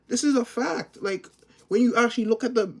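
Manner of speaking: high and loud, keep pushing the claim; fired-up rant voice; heated insistence. Real, not acted. Vlogger ranting to viewers, high and loud.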